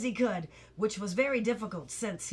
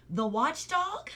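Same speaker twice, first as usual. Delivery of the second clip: higher, slow puzzled question; quizzical; 5 semitones higher than usual. A narrator's reading. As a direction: ask it slowly, puzzled, rising. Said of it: "said Milo quizzically".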